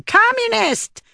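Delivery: very high, drawn-out mock shriek. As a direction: shriek it like an accusing witch finder. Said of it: "and shrieking".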